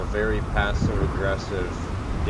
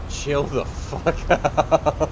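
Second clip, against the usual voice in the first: laughing; 6 semitones higher, much slower than usual. Real, not acted. Mocking the commenter, laughing.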